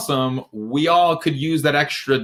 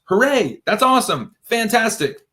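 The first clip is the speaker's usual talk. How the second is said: higher-pitched pretend cheering; mock celebration; 9 semitones higher than usual. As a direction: cheer it on, not quite for real. Mock cheering of short-lived success.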